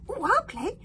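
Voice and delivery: very high eager squeals; hogfly begging to play; squealing. Acted. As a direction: squeal with delight. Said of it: "squealed the hogfly".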